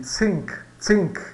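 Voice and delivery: "zinc" com TH de língua entre os dentes; TH com a língua nos dentes. Shown as a demonstration. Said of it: "com a língua entre os dentes". ("zinc" com som de TH inglês)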